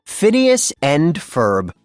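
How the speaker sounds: ordinary delivery, nothing marked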